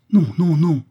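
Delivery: high, loud, insistent protest; a child's frantic refusal; alarmed protest. A narrator's reading. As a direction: cry out to stop them. Child protests threat to picture.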